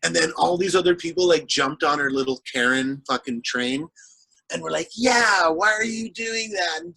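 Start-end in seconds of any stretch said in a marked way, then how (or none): 4.9-6.8 higher, slow whiny pile-on voice; mocking commenters. Commenters piling onto the offended woman, mocking whine. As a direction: whine it like a sneering crowd.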